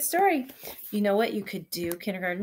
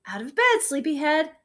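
excited; 7 semitones higher, 7 dB louder than usual. A narrator's reading. Bird wake-up call.

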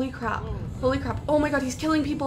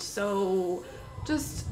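usual speaking voice

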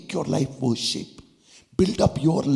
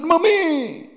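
put-on high, loud scared child cry; frightened cry; 8 dB louder than usual. Boy sees a scorpion and cries out, high scared cry. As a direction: shriek for mummy at the scorpion. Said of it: "and he said".

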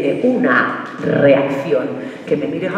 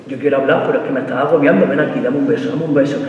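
lower, softer mock boyfriend; boyfriend's voice; 6 semitones lower, 4 dB quieter than usual. Comic imitating boyfriend asking girlfriend for a kiss, lower coaxing. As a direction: coax her like the sulky boyfriend.

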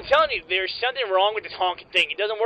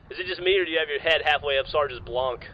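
ordinary talk

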